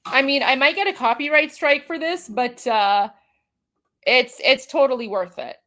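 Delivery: usual speaking voice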